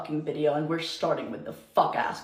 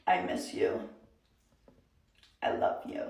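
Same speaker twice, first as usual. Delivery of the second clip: breathy mocking whisper, quieter; 2 semitones higher, 8 dB quieter than usual. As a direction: whisper it like a cheesy Snapchat caption. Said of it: "and then it was like".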